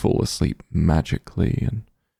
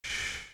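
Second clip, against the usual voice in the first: a long soft shushing hiss; gentle shush; 17 dB quieter than usual. Acted. Quieting the listener so they rest, soft shush. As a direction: hush them like a child falling asleep.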